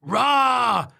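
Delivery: loud, high copycat roar; long mimicking creature yell; shouted roar. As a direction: roar it straight back. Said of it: "shouted the wobbler".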